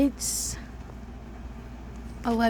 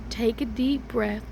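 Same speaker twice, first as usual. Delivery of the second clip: ordinary delivery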